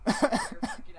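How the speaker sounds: laughing tease. Real, not acted. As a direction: tease him while cracking up. Teasing Kyle.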